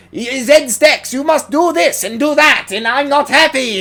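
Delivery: very high-pitched, loud little tyrant barking orders; Captain Zero. Retold or imitated. Captain Zero ordering the Zed Stacks around, high pitched and loud. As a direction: squeak out commands like a pint-sized boss. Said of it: "he talked like this at all times".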